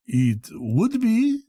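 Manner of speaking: unruffled. Acted. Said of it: "without taking notice of my irritation".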